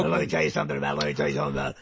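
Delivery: very deep, raspy throat voice; trying out a low, rumbling impersonation; Alex Jones. Shown as a demonstration. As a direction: drop it right down and make the throat vibrate. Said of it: "Teach me how to do Alex Jones".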